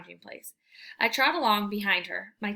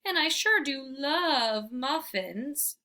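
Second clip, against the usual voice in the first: very slow, higher pleading; slow whine; 5 semitones higher, much slower than usual. Acted. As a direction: drag it out to win a muffin. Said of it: "I whine".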